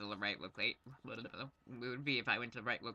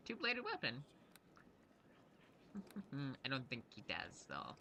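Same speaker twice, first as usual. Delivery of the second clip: usual speaking voice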